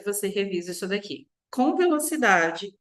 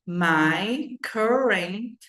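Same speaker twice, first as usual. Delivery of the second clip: very slow, word by word; slow teacher demo of current city; slow spelled-out demo; much slower than usual. Shown as a demonstration. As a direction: break it into pieces slowly. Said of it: "Som de E e um, um flat T".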